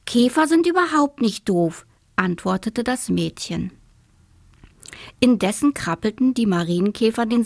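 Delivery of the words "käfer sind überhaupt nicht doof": put-on higher-pitched girl firmly objecting; emphatic, offended rebuttal; indignant contradiction. A narrator's reading. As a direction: push back against the grumbler, pitch lifted. Girl contradicts the grumbling boy.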